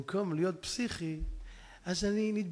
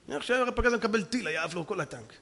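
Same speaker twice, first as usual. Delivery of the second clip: ordinary delivery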